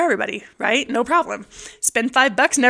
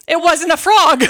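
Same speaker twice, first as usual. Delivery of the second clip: punchline; 5 semitones higher, 6 dB louder than usual. Real, not acted. Punchline reveal to friend.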